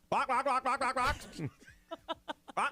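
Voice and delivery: high barking alien squawk. Pretend. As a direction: bark it out like a Mars Attacks alien. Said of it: "your alien noise that you do".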